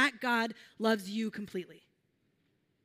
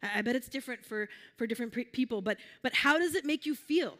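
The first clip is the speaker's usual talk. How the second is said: ordinary talk, nothing marked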